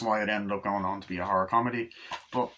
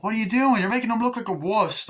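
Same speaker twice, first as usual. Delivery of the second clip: high, loud mock scolding; shrill-free loud protest in a retold scene; retold outburst; 11 semitones higher, 6 dB louder than usual. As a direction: snap at him like an annoyed kid. Kid scolds friend in retold scene.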